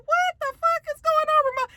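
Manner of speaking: very high, squealed question; hammed-up baffled alarm; shocked disbelief. Recapper reacts to scary Ramona, high-pitched mock shock.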